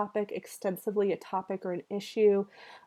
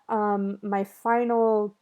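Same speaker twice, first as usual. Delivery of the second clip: ordinary talk, nothing marked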